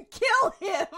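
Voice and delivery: loud, high mock command from the Force; urgent command. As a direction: belt out an order, voicing the Force. The Force urging Maul to kill.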